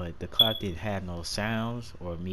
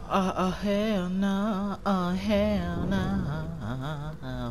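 high, very slow stretched refusal; drawn-out hell nah; 10 semitones higher, 3 dB louder, much slower than usual. Real, not acted.

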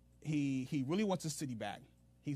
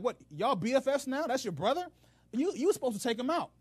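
higher, louder scolding rebuke; rebuking prophet; 10 semitones higher, 5 dB louder than usual. Retold or imitated. Prophet rebuking Ahab, higher and scolding. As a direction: scold him like a let-down prophet. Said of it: "a prophet comes to him and rebukes him".

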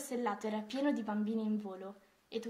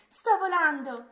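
high, loud, drawn-out cry; joyful shout; 6 semitones higher, 11 dB louder, slower than usual. A narrator's reading. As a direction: call it out slowly to those far below. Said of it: "gridò Mia ai pesci". (grido esultante)

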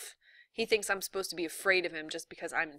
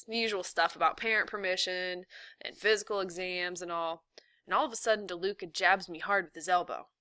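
ordinary delivery

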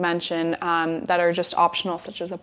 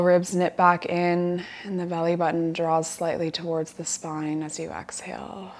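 guided relaxation. Acted. Yoga teacher guiding students lying down to relax, guided relaxation. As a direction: settle them gently into the breath, unhurried.

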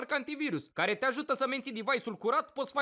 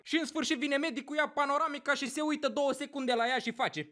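usual speaking voice

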